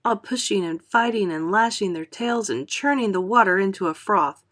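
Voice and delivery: ordinary delivery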